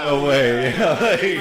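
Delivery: high, loud, laughing disbelief; excited. Retold or imitated. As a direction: laugh it out, amazed. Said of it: "I was like".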